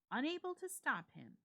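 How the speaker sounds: plain reading voice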